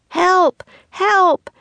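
very high, loud cry for help; yelling at the top of his lungs. A narrator's reading. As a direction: scream for help so they turn around. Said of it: "he yelled at the top of his lungs".

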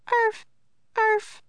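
very high little yaps; dog barking. A narrator's reading. Little dog calling from a distance, high barking. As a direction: yelp like a small dog. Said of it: "The barking came from a distance".

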